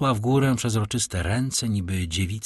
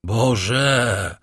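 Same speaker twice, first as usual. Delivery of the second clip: drawn-out, falling exclamation; shocked cry; 4 dB louder than usual. A narrator's reading. Count shaken by the girl's gaze, crying out. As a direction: cry it out from the chest, stretched. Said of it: "zawołał".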